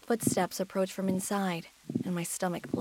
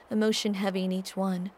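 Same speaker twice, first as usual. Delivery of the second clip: ordinary delivery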